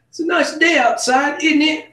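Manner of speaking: high, loud London cab driver; London cabbie accent. Retold or imitated. Passenger imitating his London cab driver, high English accent. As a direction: chirp it in a mock English accent. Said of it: "that's an English accent".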